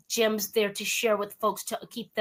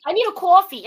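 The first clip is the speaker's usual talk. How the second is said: put-on New York accent; 7 semitones higher, 7 dB louder than usual. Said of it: "it rubs off when you spend any time in New York".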